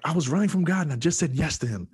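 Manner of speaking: high-pitched, breathless-with-news outburst; excited declaration. Retold or imitated. Patient telling the doctor of his surrender, excited outburst. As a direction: blurt it out, pitched well up.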